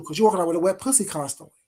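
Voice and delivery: ordinary talk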